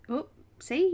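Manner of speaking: ordinary talk, nothing marked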